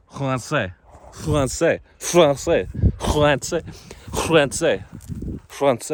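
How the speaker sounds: France with a throaty French r, trying out a native French accent; French accent attempt. Shown as a demonstration. Trying to say France like a native speaker, mock French accent. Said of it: "a native French accent".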